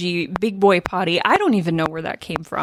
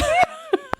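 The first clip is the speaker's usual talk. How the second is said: high, breathless wheeze of laughter; wheezing laugh. Shown as a demonstration. Wife recalling losing it over the joke, wheezing laugh. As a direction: laugh until only a wheeze comes out. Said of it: "and then just wheezing".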